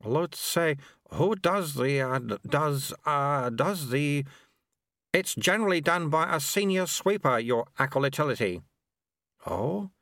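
slow, halting flustered burble; a lost official fumbling for words; burbling. Acted. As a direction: stumble and lose the thread. Acolyte fumbling.

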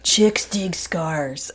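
stunned. Real, not acted. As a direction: mull "Chicks dig scars" over in awe. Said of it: "I mean, wow".

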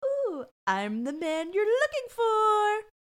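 high, drawn-out creepy sing-out; creepy suspect. Retold or imitated. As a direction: stretch it out slowly with a creepy smile. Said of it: "gives her a really creepy smile".

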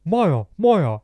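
high, loud mocking drunk lad voice; drunk mock impression of Jeevan. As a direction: ham up a drunk friend on the phone. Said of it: "this isn't how he sounds".

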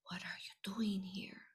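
hushed worried whisper; a soft secret question under the breath; girl whispering to a small boy in the dome. Retold or imitated.

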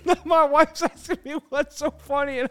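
high-pitched helpless laughter; can't stop laughing, gasping; laughing fit. Real, not acted. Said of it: "I laugh so much on Saturday night".

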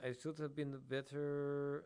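put-on slow, heavy Russian accent. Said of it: "That's, that's, that's Russian".

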